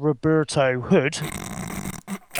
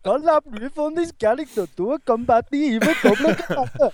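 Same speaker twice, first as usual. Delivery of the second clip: put-on Swedish accent; 7 semitones higher than usual. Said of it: "totally doing this in a Swedish accent".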